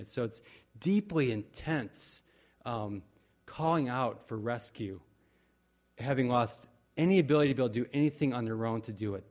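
ordinary delivery

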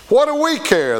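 high, dismissive comeback; retort. Acted. Priests answering remorseful Judas, dismissive retort. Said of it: "they retorted".

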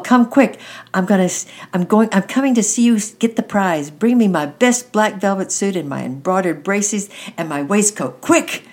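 excited shout, pitched high and loud. A narrator's reading. Boy in bed orders his sister to hurry, shouting. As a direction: holler urgently for your sister. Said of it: "sat up in bed and shouted".

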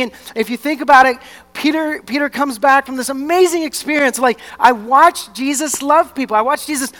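fervent sermon storytelling at high energy; impassioned preaching. Real, not acted. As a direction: preach it with full conviction, punching key words.

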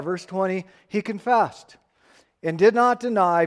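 ordinary talk, nothing marked

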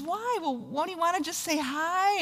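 high, puzzled mock question; retold talk-show host. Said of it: "And, and Ellen says".

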